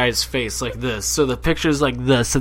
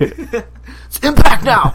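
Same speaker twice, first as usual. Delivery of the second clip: high, loud, drawn-out correction; mock outburst; 11 semitones higher, 11 dB louder, much slower than usual. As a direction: shout the correction slowly, way up high. Mock correction of TNA's name.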